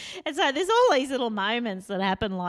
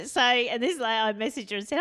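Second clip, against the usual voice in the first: usual speaking voice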